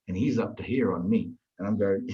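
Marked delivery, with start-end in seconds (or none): none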